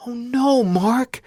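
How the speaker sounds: very high, loud, horrified cry; a sister hearing her brother's son is dead; screaming in shock. Retold or imitated. Sister hearing the terrible news on the phone, screaming in shock. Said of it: "She screamed".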